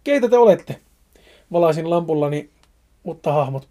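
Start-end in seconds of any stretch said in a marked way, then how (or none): 0.0-0.8 higher, louder demand to shapes in the dark; hikers's alarmed shout at looming figures; frightened shout. Acted. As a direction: shout Keitä te olette as a scared challenge.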